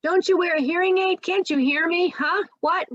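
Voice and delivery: high, loud, impatient repeated questions; loud frustrated asking. Retold or imitated. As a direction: demand it, fed up and loud. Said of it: "people might say".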